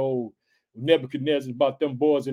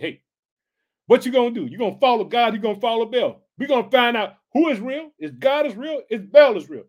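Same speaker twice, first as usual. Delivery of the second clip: a higher, louder challenging prophet; Elijah calling out; 6 semitones higher, 5 dB louder than usual. Retold or imitated. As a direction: call the people out like Elijah. Elijah voiced calling out Israel.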